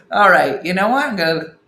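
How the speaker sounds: normal talking voice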